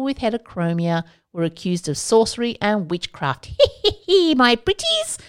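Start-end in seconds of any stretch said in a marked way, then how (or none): 3.6-5.2 bad witch impression. Podcaster sending up witchcraft accusations, bad witch impression. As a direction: do a hammed-up witch with a squealed cackle. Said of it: "really bad impression of a witch".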